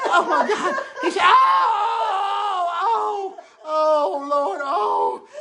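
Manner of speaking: very high, loud hammed-up oh of pain; mock pained cries. Drunk man cries out as the leprechaun gets him. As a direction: wail it out, laughing through it.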